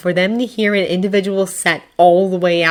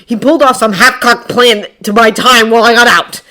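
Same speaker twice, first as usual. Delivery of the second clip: loud, raised agitated voice; angry growl; 5 semitones higher, 9 dB louder than usual. A narrator's reading. Bakugo explains the escape plan, growling. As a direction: report it with irritation. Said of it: "he growls".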